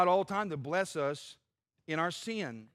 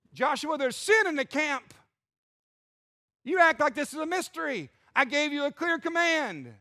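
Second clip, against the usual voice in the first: stern rebuke; 6 semitones higher than usual. Acted. God scolding Joshua.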